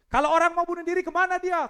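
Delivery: high, loud preaching cry; shouted question. Acted. Preacher asking the congregation, high loud shout.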